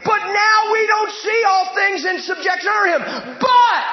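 loud, rising preacher build; preaching at full force. Acted. Preacher reading scripture to the church, loud.

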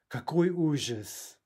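slow, theatrical dismay; hammed-up horror, drawn out; mock horror. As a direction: play the tragedy slowly.

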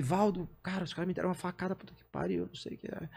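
high, hushed plea fading into a whisper; retold distress. Stabbed man tells his friend about the knife, hushed distress. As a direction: start high and soft, lose the voice to breath.

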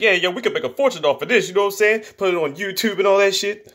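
high-pitched imitation of an excited pitch; mock eager scheming. Acting out excited money-making scheme.

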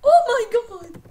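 loud high oh my god; shrieking exclamation. Real, not acted. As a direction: shriek it out in shock. Big surprise in game.

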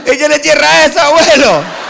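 high, loud taunting yell at grandpa; mock the old man from afar. A beachgoer taunts the naked old man, high and loud.

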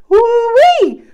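high, loud whoop of delight; excited whoop. Real, not acted. Reader thrilled by a card, whooping. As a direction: let out a laughing woo-wee.

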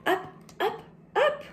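soaring-kite pitch; rising voice. Acted. Kite soars.

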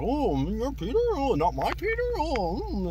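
high put-on goofy Sandman voice; mock dopey high voice; imitating Sandman. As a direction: squeak it out like a confused cartoon villain. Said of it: "He's like".

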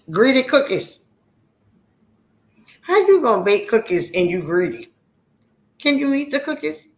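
high, loud mock scolding; calling out. Teasing Greedy Cookies about baking cookies, calling out.

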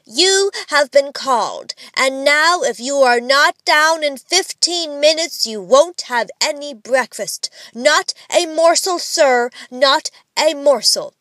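loud, high, impressive mock scolding; emphatic, pompous reprimand; stern father imitation. A narrator's reading. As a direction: play the stern father laying down the law. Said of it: "assuming the stern air of his father".

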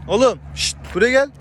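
high, loud call ending on a rising gel. Real, not acted. Owner calling his animal over, high and loud. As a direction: coax the animal over from afar.

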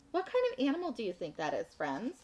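ordinary delivery

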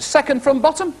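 high-pitched heckler's comeback; imitated punter piping up; heckling fella. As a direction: call it up high from the crowd. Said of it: "this fella said".

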